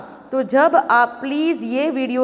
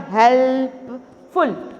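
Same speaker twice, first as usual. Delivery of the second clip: "Helpful" slowly in separate parts; deliberate syllable breakdown of "helpful"; split into syllables; much slower than usual. Shown as a demonstration. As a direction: hold help, pause, then फुल in "helpful". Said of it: "तो three syllables".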